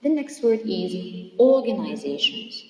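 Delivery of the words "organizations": "organizations" said loudly for a class, stressing ZA; louder teacher's demo of "organizations"; stress second-last syllable. Shown as a demonstration. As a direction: model "organizations" as organi-ZA-tions. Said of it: "is stressed also in the".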